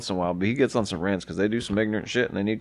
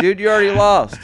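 mock taunt; 10 semitones higher, 7 dB louder than usual. Mocking Ciampa taunt.

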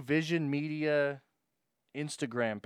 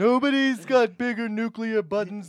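slow, loud, higher boastful voice; Trump impression; 7 semitones higher, 7 dB louder than usual. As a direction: puff up like Donald Trump.

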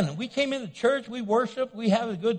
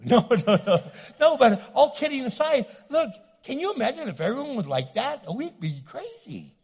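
ordinary talk, nothing marked

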